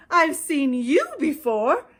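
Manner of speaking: high, loud bird voice; Maisie, shocked. A narrator's reading. Maisie recognising Horton in the tent. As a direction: accuse him with surprise. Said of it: "gasped Maisie".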